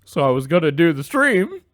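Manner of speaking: high-pitched imitation of a colleague; mock coworker voice. Retelling the coworker's stream that fell through, high mock voice. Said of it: "He's like".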